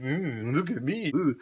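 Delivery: soft, mincing mock of a selfie poser; imitating a vain show-off, hushed. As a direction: coo it quietly in mockery. Mocking the selfie stick poser.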